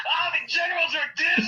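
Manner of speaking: high, laughing clown voice; cackling cartoon clown line; Krusty the Clown. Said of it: "your best Krusty the Cra-, Clown impression".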